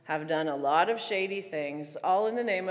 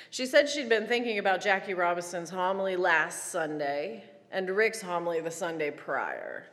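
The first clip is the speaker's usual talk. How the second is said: normal talking voice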